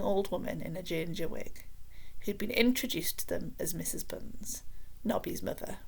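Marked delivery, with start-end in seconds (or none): none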